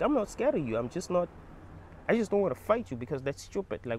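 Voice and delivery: ordinary delivery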